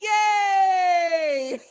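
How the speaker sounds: high, loud cheering finish; joyful cheer. Acted. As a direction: cheer the happy ending like a crowd. Cheering ending of the comic.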